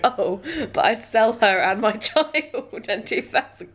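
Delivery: mock offer broken up by laughter; laughing imitation. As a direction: struggle to finish the quote while laughing. Laughing while quoting the barterer.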